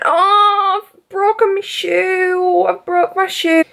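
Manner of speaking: put-on high, drawn-out drunken wail; drunk woman. Drunk stranger calling out about her shoe, hammed-up high wail. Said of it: "this really drunk woman".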